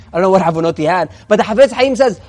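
ordinary delivery

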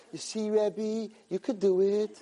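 high, drawn-out schoolkid voice; imitating kids. Students cheer on their rabbi.

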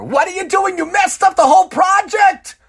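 high, loud furious shout; angry yell. Acted. Angry boss shouting at an employee, furious yell. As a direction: blow up at him over the project.

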